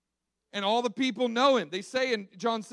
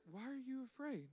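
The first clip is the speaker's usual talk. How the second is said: far softer, gentle and unbothered; calm hush; 3 semitones higher, 19 dB quieter than usual. Retold or imitated. Jesus waking up and calmly asking the frightened disciples, hushed. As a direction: settle them with a soft question.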